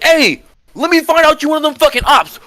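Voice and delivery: the barking New York tough guy; New York accent. Retold or imitated. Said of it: "let me put my New York accent".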